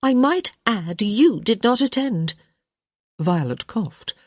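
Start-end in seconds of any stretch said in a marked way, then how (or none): 0.0-2.4 pointed. Acted. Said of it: "she said quite pointedly".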